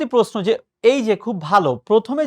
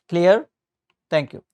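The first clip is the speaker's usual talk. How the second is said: ordinary talk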